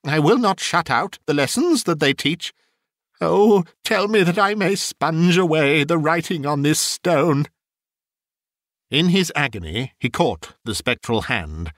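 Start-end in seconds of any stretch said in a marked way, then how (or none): 0.0-7.5 higher-pitched, desperate Scrooge begging for mercy; pleading Scrooge. Acted. Scrooge begs the spirit to change his fate, pleading. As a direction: implore with earnest, raised pitch.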